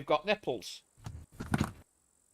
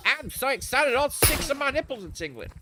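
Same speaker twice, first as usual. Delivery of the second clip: Red Dwarf cat; 7 semitones higher than usual. Retold or imitated. How they used to quote it out drinking, the giddy Cat. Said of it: "the cat out of Red Dwarf".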